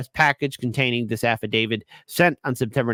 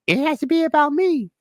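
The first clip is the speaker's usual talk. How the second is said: much higher, mock self-important voice; mocking whiny diva. Host mocking the campaign's demands, mocking high voice. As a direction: squeal it in a high put-on voice.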